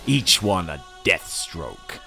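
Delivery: ordinary talk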